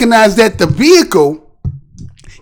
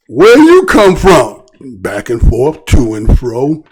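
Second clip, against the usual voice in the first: slow, lower biblical voice; quoting God and the devil; 10 semitones lower, slower than usual. Retold or imitated. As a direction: intone it slowly like scripture. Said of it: "the Bible says that God asked the devil".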